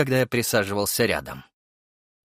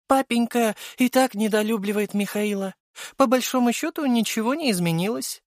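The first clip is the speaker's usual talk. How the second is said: high female voice, airy; high-pitched, unbothered female character; young noblewoman character; 13 semitones higher than usual. A narrator's reading. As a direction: argue coolly in a high voice. Venera defends narrator in character voice.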